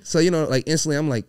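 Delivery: ordinary talk, nothing marked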